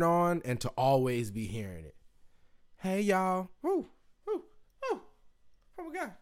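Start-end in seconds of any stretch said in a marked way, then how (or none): 2.8-3.5 higher-voiced Southern greeting; imitated Southern drawl, pitched up; Southern accent. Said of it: "everybody talks like that". 3.6-5.0 short high falsetto whoops; swooning woo. Real, not acted. Swooning over Southern accents. 5.8-6.1 soft, very high "oh my God"; hushed high-pitched swoon. Real, not acted. Swooning over Southern accents.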